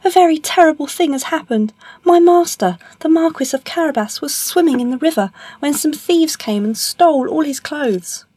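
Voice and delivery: very high, urgent storybook voice; alarmed cat. Acted. Puss reporting a theft to the king, alarmed. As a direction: raise the pitch high as if distressed.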